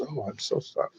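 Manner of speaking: hushed, very soft sympathy; sincere. Retold or imitated. Star hearing that Father is dying, sincere and hushed. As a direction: drop right down to a sincere, quiet condolence. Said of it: "he was really sincere".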